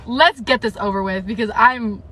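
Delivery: ordinary talk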